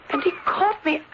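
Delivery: crying through it; tearful. Acted. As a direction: voice catching with tears. Shaken girl recounting near fall.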